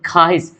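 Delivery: खाहिश with no "w", "khaa-hish"; as spoken. Shown as a demonstration. Teacher showing how people say it, खाहिश without "w". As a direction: drop the "w", say खाहिश. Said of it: "क्या बोलते हैं".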